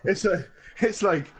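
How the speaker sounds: usual speaking voice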